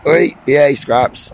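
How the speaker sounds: sharp, loud, high call; calling the dog. Real, not acted. Owner breaking off to call Scraps, real loud shout.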